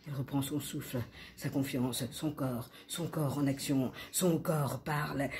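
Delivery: subdued narration. A narrator's reading. Narrator as La L regains breath and confidence, subdued. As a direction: settle down and breathe between phrases.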